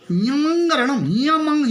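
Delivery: "यमंग रणम" hummed through the nose; nasal. Shown as a demonstration. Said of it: "यो नाक से". ("यमंग" नाक से बोलें)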